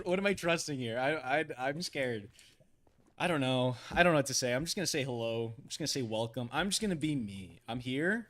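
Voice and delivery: ordinary talk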